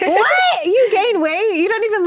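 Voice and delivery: high, loud gasp of disbelief; can't believe what she just heard; shocked disbelief. Real, not acted. Host stunned by guest's pregnancy weight gain, shocked disbelief.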